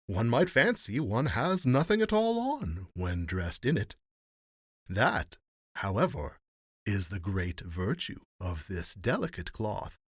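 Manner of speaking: high, emphatic presenting voice, easing down at the end; showy salesman rogues. A narrator's reading. Rogues presenting invisible garments to the emperor, showy. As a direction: show off each piece with lilting flourish.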